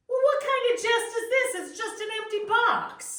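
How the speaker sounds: outraged at the empty box; indignant surprise. Acted. Opens an empty gift box.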